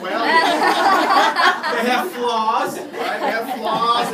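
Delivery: loud, high laughing through the words. Real, not acted. Admitting to the audience that parents have flaws, laughing.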